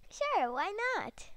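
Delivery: high bright sure why not; exaggerated cheerful agreement; enthusiastic. Emphatic retake.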